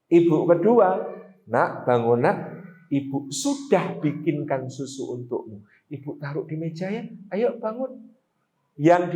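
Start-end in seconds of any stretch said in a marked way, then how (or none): 1.5-8.2 low, soft, gentle waking voice; a quiet, loving mother's murmur; soft kind mother. Shown as a demonstration. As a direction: tenderly wake a sleeping child. Second mother waking child again.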